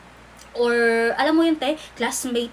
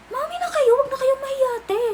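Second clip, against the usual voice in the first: teasing squeal; 12 semitones higher than usual. Acted. Teasing viewers to admit.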